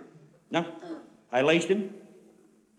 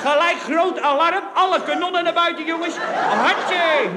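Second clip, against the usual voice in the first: excited; 7 semitones higher, 5 dB louder than usual. Acted. Telling of the full alarm.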